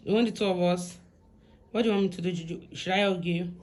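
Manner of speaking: ordinary delivery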